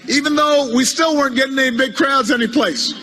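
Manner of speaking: ordinary talk, nothing marked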